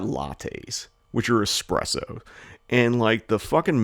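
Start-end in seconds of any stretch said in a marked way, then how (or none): none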